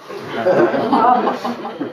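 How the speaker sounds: a high breathy laugh. Real, not acted. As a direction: laugh at the flimsy boat. Guide laughs telling story.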